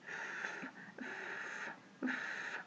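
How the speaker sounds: long voiceless "ف", just air; letter F sound. Shown as a demonstration. Teacher showing how letter F is pronounced, long airy "ف". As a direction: hold "ف" as soft friction without voice. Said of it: "اسمه F بيتنطق".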